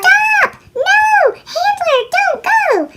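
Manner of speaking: high, loud panicked yell; desperate high shout near the cliff edge. A narrator's reading. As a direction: scream at the driver to stop. Panicked cry as car nears cliff.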